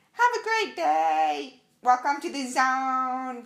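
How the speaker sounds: very high, slow, loud sing-song welcome; chirpy greeter. Retold or imitated. Greeting everyone coming into the lobby, high sing-song welcome. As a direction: beam out a bright customer greeting.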